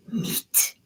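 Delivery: whispered bunny voice; hushed, voiceless critter whisper; creepy bunny. Acted. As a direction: whisper it as a hungry little creature. Game master voices the carnivorous bunny.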